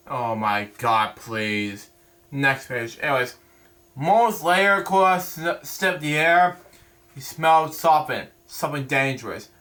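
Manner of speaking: ordinary delivery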